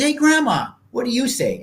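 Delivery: high-pitched call across the table; calling to grandma. Retold or imitated. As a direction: imitate a relative prompting grandma. Acting relative calling to grandma at dinner.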